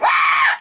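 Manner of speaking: breathy scream. Retold or imitated. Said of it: "And then she's like".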